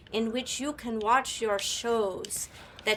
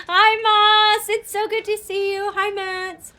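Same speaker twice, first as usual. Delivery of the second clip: high, loud, gushing hello; excited greeting; 10 semitones higher, 11 dB louder, slower than usual. Real, not acted. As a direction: squeal a delighted hello. Greeting a game character.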